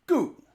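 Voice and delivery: Goo said with a sharp falling tone. Shown as a demonstration. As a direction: drop hard on Goo, Mandarin fourth tone. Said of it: "This is the falling tone".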